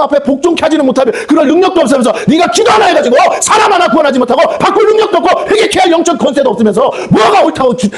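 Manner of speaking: high, shouting rebuke from the pulpit; full-force preaching. Acted. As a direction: thunder at the congregation, pitch climbing. (높은 목소리로 외치는 설교)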